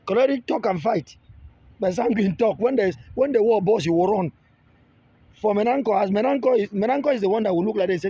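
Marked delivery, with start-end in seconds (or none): none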